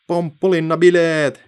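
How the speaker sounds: loud, high, stretched shout. A narrator's reading. Apples shout in joy while bouncing on the net. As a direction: shout it out slowly with glee. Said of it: "he huusivat".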